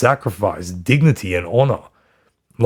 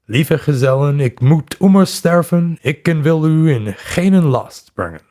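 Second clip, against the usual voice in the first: put-on old Dutch quote; 4 semitones higher than usual. Commander addressing his troops before the leap, in old Dutch. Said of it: "Oh, what a crappy accent".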